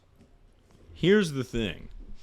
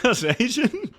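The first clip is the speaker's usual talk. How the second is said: higher, breaking into a laugh mid-question; 14 semitones higher than usual. Real, not acted. Streamer rereading a chat question, laughing through it.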